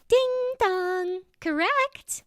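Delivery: smug game-show ding dong; cocky. A narrator's reading. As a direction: gloat it out, high and pleased. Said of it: "with a cocky attitude".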